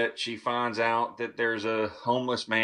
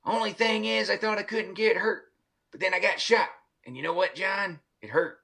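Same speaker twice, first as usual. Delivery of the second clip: high, aggrieved doll voice with a laugh; Chucky voice; 8 semitones higher than usual. Retold or imitated. Doll complains to the voodoo man about getting shot, high voice. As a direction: complain like the wounded killer doll. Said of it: "he's like".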